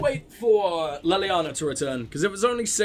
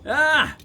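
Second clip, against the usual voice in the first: sudden sharp cry of surprise; startled yelp; 7 semitones higher, 6 dB louder than usual. Real, not acted. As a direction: yelp at the screen. Startled by the game.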